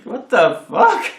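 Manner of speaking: high, loud, slow disbelief; retold stunned outburst; shocked. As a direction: drag it out in total disbelief. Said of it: "I was like".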